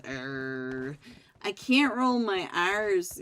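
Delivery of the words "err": monster growl. Pretend. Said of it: "can you make a little monster voice like this?".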